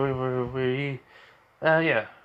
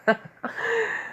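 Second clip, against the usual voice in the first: radio demon giggle; 3 dB quieter than usual. Retold or imitated. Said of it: "Imagine him just doing the Radio Demon's voice".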